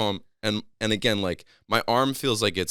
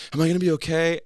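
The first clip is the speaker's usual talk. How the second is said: higher, louder worried question; mock panicky; 9 semitones higher, 6 dB louder, faster than usual. Patient asks doctor about injury, mock panicky. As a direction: play a patient fretting, briefly. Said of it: "I'm not panicky. I'm not like".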